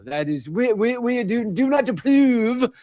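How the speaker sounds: put-on British accent rising high and stretched out; mock British accent. Host voicing Russia and China's objection, in a slow mock British accent. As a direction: play a stuffy British diplomat, drawn out. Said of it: "giving them a British accent".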